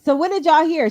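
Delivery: ordinary talk, nothing marked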